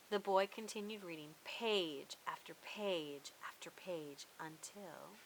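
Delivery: hushed, unvoiced suspense whisper. A narrator's reading. Narrator building suspense for young listeners, whispered.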